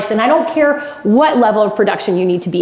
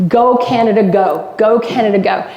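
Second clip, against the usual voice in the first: ordinary delivery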